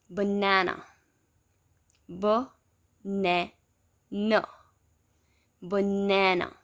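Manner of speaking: banana split into its syllables, middle a strong. Shown as a demonstration. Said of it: "we have a as the strong ones".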